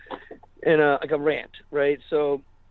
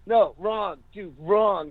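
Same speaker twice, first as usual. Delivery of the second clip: higher-pitched insistent refusal, acted out; imitated rejection; 6 semitones higher, much slower than usual. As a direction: shoot it down as the bandmates would. Bandmates reject his lyrics.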